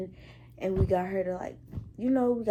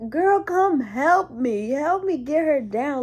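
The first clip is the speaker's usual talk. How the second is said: loud, high, drawn-out appeal; urgent call for help; 6 semitones higher, 8 dB louder, slower than usual. Retold or imitated. Nurse begging colleague to help hold patient, loud urgent call. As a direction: call her over urgently, louder and higher.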